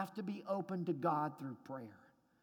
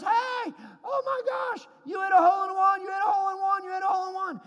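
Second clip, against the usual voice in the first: much higher, louder ecstatic yelling; excited shouting; 11 semitones higher, 9 dB louder than usual. Retold or imitated. Golfer shouting to his friend about the hole in one. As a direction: scream it with joy, over and over. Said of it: "one of the other good golfers goes".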